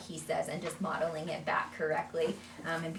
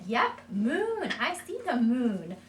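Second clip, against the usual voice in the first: high, loud, slow "Yep, moon"; validating praise; 7 semitones higher, 6 dB louder, much slower than usual. Shown as a demonstration. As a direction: cheer the child's word warmly. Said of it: "So validate it".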